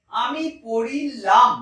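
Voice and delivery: পড়িলাম drawn out slowly and loudly, stressing the আম ending; teacher sounding out পড়িলাম for the class; slow demo pronunciation. Shown as a demonstration. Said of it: "পুরুষবাচক বিভক্তি উচ্চারণ করব".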